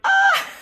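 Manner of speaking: very high, loud fangirl squeal; delighted squeal. Retold or imitated. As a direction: let out a sharp squeal of joy. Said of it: "And I was just like".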